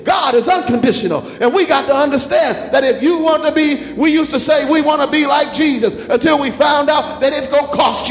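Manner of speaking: ringing sermon cadence, pushing each line harder; preaching at full force. Acted. Preacher rallying the congregation, in full-force preaching cadence.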